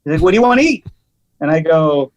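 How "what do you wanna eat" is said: mock impatient waiter, pitched higher; pushy waiter. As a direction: press him for his order like a gruff waiter. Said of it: "Really super aggressive".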